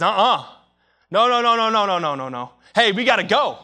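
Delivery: loud urgent "no, no" then "we gotta go"; urgent onlooker. Retold or imitated. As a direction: refuse to be left behind on the shore. Voicing onlooker urging to follow.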